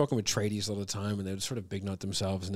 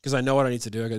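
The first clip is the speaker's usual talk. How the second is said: ordinary talk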